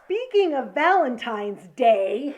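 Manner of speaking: loud, slow, stretched-out announcement; emphatic segue. Real, not acted. Co-host pushing on to her Valentine story, emphatic. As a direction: boom out the change of topic, drawing it out.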